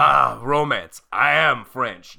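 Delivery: put-on slow, loud, hammy French accent; fake French accent. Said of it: "weird fake French person".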